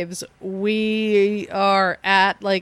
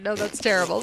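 usual speaking voice

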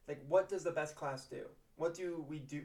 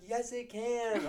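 high, slow, drawn-out retort; mock insistence; 8 semitones higher, slower than usual. Pupils answering teacher's antics, high drawn-out insistence. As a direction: imitate kids insisting back. Kids argue back.

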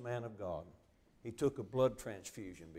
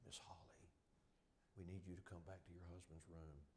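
soft unvoiced intercom call, much softer; whispered page; 4 semitones lower, 17 dB quieter than usual. Intercom quietly summons the wife, whispered. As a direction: hush it like a hushed hospital page.

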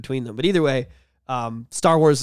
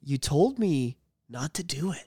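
slow and much softer; soft retold line; 7 dB quieter than usual. Host quoting Hayden to cohost, slow and soft. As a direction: hush it, deadpan. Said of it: "he looks at him and he goes".

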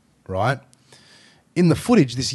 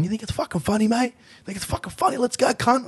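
higher-pitched mocking taunt of a drunk picking a fight; aggressive drunk; 11 semitones higher than usual. Drunk at Macca's picking a fight with two mates, mock taunting. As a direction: jeer like a bloke itching to punch on. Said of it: "I can hear this guy going".

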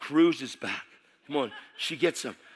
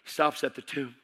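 ordinary delivery

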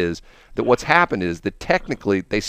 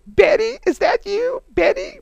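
much higher, louder, teasing Benny call; mocking high lady voice; 4 dB louder, slower than usual. Officers teasing Benny over the radio, high falsetto. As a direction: squeak it like mocking the secretary. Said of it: "other officers ... just go".

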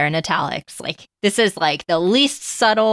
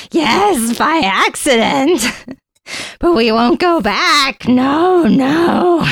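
high, loud, drawn-out creature voice; Gollum voice; 6 semitones higher, 4 dB louder, much slower than usual. Acted. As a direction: cry out in hammed-up creature protest. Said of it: "his voice and language changed".

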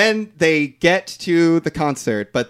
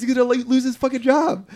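ordinary delivery

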